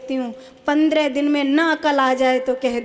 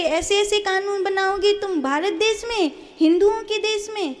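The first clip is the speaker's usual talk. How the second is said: fiery preaching; 7 semitones higher than usual. Acted. Preacher rousing her listeners, high-pitched and indignant.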